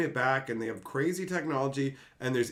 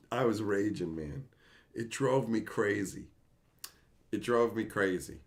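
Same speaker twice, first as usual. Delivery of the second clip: ordinary talk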